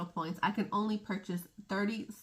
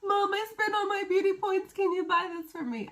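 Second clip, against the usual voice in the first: put-on much higher, wheedling little-girl voice; pleading kid voice; 11 semitones higher than usual. Daughter begging her mother for makeup, high wheedling plea. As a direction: beg Mom sweetly like a child. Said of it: "trick him or my mother into saying".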